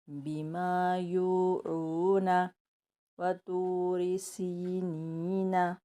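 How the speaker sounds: slow, careful Quran reading; slow recitation. Shown as a demonstration. Teacher reciting verses for the class, slow and careful. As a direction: recite each word slowly for pupils to repeat.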